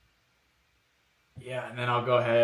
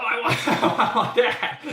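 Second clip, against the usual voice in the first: laughing; 11 semitones higher, 10 dB louder than usual. Pretend. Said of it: "you're gonna be like".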